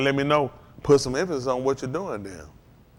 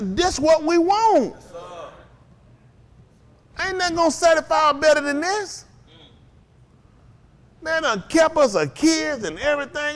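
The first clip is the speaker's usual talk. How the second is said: fiery preaching; 10 semitones higher than usual. Acted. Preacher rousing the church about God's provision, full-force preaching. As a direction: preach it with fire to the congregation.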